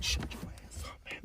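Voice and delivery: hushed, voiceless scolding; urgent whisper. Real, not acted. Dad whispering to hush his son in line. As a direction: hiss it under your breath so nobody hears.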